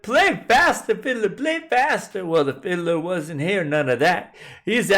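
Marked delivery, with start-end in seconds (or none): 0.0-2.3 higher, louder hollered order; hollering. A narrator's reading. Widow hollering at the fiddler, loud and high. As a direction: shout him on to play. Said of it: "The widow hollered".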